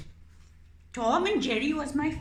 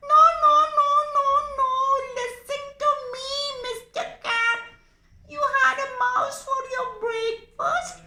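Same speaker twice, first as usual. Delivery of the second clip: much higher, loud squeaky begging; squeaky pleading mouse; 7 dB louder, much faster than usual. Acted. Mouse puppet begging the cat not to eat it, squeaky. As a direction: squeak for your life.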